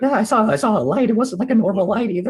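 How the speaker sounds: a hint of an excitable watchman; uneasy lookout. Player in character insists on sighting.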